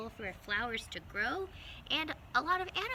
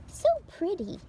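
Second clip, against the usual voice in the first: admiring little mouse; 11 semitones higher, 10 dB louder than usual. Acted.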